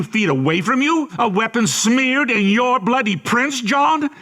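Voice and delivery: high-pitched, incredulous tirade, rising steadily; mounting accusation. Acted. Interrogator builds an incredulous accusation.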